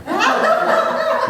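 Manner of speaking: high burst of laughter. Real, not acted. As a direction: laugh at the thrift-shop story. Laughs at own anecdote.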